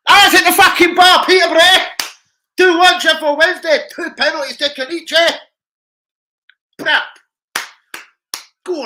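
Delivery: loud high goal celebration; excited yelling. Real, not acted. Fan yelling at the telly as his team scores, loud and high. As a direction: scream the score like the match is live. Reacting to live football goal.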